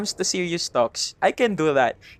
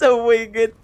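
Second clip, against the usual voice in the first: normal talking voice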